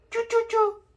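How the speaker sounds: high squeaky mouse noise; mouse squeak. Pretend. Parent makes mouse sound.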